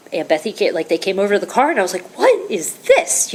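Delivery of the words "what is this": very high, slow "What is this?"; baffled surprise. Retold or imitated. Watching husband hug a stranger, bewildered. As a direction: stretch it out in disbelief.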